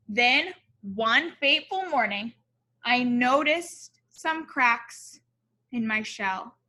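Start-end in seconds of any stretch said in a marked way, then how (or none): none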